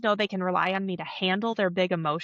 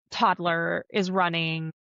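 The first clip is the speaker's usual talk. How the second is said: ordinary talk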